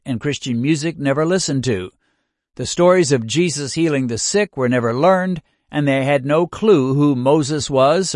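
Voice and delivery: ordinary talk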